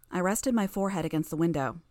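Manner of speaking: usual speaking voice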